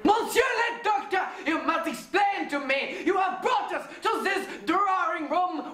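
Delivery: indignant French detective, near shouting; a French inspector demanding answers from the doctor; French accent. Acted.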